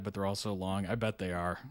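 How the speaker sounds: ordinary talk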